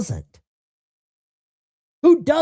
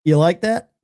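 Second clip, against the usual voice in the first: usual speaking voice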